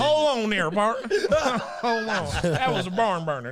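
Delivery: cowboy voice. Pretend. Joins the cowboy bit, laughing.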